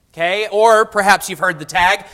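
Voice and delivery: usual speaking voice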